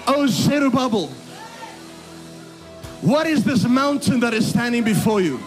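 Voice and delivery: usual speaking voice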